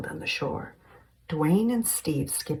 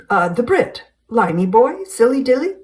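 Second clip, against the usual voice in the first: loud, rising mockery; mocking; 7 semitones higher, 11 dB louder than usual. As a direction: jeer at the Brit. Frank mocks Basil.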